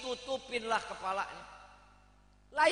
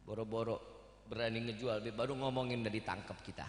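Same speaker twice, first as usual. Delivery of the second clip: low aside; 14 semitones lower, 8 dB quieter, faster than usual. Real, not acted. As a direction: drop way down, murmured aside. Aside about fear of arrest. (gumaman pelan dan rendah)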